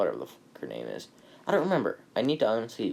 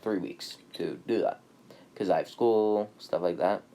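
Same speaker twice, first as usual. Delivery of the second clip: ordinary talk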